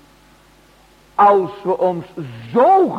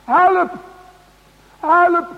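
higher, louder cry of Help!; 6 semitones higher, 3 dB louder than usual. Retold or imitated. Preacher voices a drowning man shouting for help. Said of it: "die schreeuwt en die roept".

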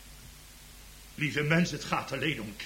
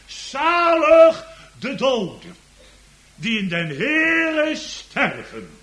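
raised, swelling pulpit voice; impassioned preaching; 9 semitones higher, 10 dB louder than usual. Real, not acted. Preacher proclaims to congregation that Christ turns no sinner away, impassioned. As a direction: build to a loud, high, drawn-out scripture cry.

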